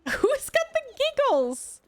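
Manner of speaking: laughing. Real, not acted. Creepy giggling doll in game.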